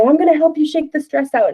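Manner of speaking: high, bouncy playful offer; playful parent. Pretend. Parent grabbing child's arm to play, high playful voice. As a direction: tease the child into playing along. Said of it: "grab their arm or something and say".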